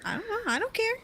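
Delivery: put-on high, bratty child's answer; defiant child. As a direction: shrug it off like a cheeky kid.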